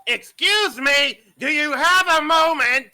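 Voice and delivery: loud, slow call across a room. Acted. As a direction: call out to get a stranger's attention. Calling out to stranger in game.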